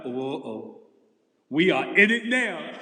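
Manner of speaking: low, slow "we are in it now"; a kid realizing they're in trouble, hammed up; mock dread. Kids dreading angry parents.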